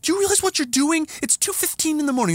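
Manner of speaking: high, fast, raging reprimand; furious yelling. Retold or imitated. Scolding the neighbor at two fifteen in the morning, furious. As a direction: tear into him, imitating his own fury. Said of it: "now I'm furious and my voice matches".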